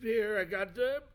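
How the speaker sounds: high, loud cheery voice; high put-on voice. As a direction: bounce in with the drinks, high and loud. Returning with drinks, put-on voice.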